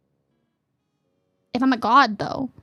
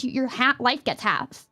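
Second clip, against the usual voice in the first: ordinary talk, nothing marked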